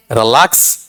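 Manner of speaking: Relax with a light first syllable and a rising, stressed लै; weak रि, then push up on lakhs; iambic stress. Shown as a demonstration. Teacher demonstrates an iamb, relax stressed on the second syllable. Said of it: "unstressed followed by stressed".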